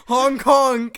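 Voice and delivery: loud high honk honk. Pretend. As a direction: honk like a trunk. Imitating elephant trunk honk.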